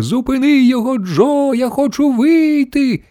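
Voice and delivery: high panicky wail, stretched at the end; grandmother's storybook screech; shrieking. A narrator's reading. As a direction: squeal to be let out, way up high. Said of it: "верещала бабуся Джозефіна".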